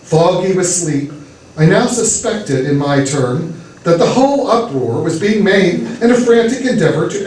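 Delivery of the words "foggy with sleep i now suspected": plain reading voice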